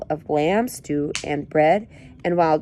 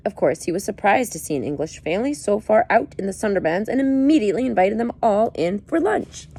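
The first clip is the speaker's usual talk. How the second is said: plain reading voice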